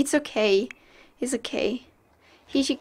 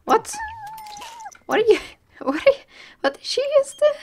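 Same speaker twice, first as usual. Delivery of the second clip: shocked; faster than usual. Real, not acted. Shocked at pet's action.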